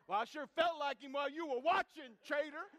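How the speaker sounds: playful loud jab; loud teasing retort. Retold or imitated. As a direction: throw it back at the friend, laughing. Teasing reply to friend.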